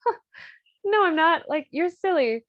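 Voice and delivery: high, loud brushing-off; mock denial. Countess denies to the Count that she's worked up, high mock innocence.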